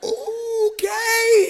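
a high, loud cried-out okay; shocked okay. Retold or imitated. Preacher acting out a shocked crowd, loud cry. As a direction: blurt it out in disbelief.

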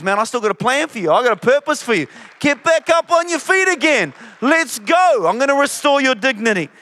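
stirring, building encouragement; God rallying the fallen. Acted. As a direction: lift them to their feet. Preacher voices God rallying people.

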